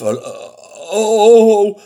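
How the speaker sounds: alarmed, swelling "ohhh"; shocked gasp. Acted. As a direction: cry out at a sudden monster. Mouse shocked by the monster.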